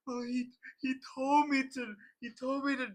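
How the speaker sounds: high, near-laughing plea; panicked whine. Retold or imitated. Nervous student blaming the instructor, high panicked whine. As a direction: whine it out like a scared teen caught out. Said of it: "I'm like".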